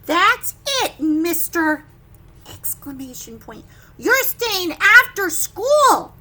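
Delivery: loud, slow, fed-up scolding; teacher punishing David for drawing on his desk; angry teacher. Acted. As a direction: lay down the punishment. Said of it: "his teacher says".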